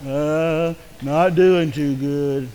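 lightly voiced glum Eeyore, slow and dragging; a hint of mopey Eeyore; gloomy Eeyore. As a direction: drag it out like a mopey donkey. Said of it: "Okay, Eeyore".

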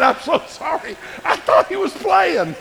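high, loud, slow apology through laughter; laughing apology. Retold or imitated. Preacher apologizes to the kid's dad, laughing loudly. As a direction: laugh out an embarrassed sorry.

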